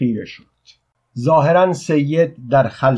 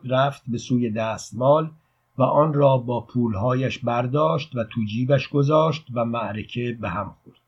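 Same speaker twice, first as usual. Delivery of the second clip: plain reading voice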